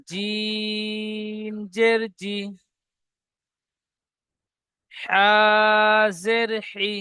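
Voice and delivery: জিম জের জি stretched out slowly; slow spelled-out syllables. Shown as a demonstration. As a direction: recite each letter with জের slowly. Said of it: "ঠিক এমন ভাবে আলিফ থেকে ইয়া পর্যন্ত". (ধীরে ধীরে জের দিয়ে পড়া)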